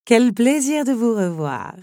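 stately countess greeting a dear guest; countess's cry. A narrator's reading. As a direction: draw out a grand welcome.

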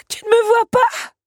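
high, loud despairing shout; desperate cry. Acted. Belle unseen by Big Ben, desperate shouting. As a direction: cry out as if nobody can see you. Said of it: "se désespéra Belle".

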